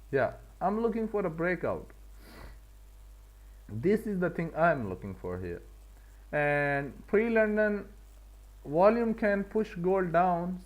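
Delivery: usual speaking voice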